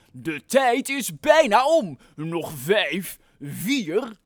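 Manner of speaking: loud, slow announcer call; megaphone countdown. Acted. The megaphone man warns the builders time is nearly up, loud countdown. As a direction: boom the countdown. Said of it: "begon de meneer met de megafoon te roepen".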